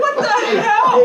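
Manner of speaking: very high, loud incredulous outburst; mock disbelief. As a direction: exclaim it with exaggerated, laughing bafflement. Acts out baffled reaction to hat.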